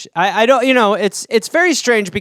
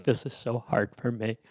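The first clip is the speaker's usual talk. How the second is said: much softer, lower mopey voice; whiny self-pity; 9 semitones lower, 14 dB quieter than usual. Acted. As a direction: sulk as you pack your knives. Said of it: "be like".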